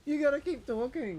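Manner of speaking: high squeaky mimic of the nag; high mocking voice. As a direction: parrot it back in a silly high voice.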